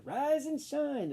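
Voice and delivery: high, slow sing-out; wake-up call. Acted. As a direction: drawl it like a morning call. Waking the leaves.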